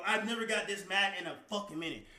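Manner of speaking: usual speaking voice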